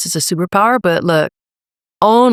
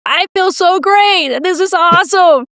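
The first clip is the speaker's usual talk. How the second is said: high, drawn-out gushing delight; elated client; 12 semitones higher, slower than usual. Retold or imitated. Coaching client reporting back a week later, elated. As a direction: imitate someone thrilled, pitch way up and stretched out.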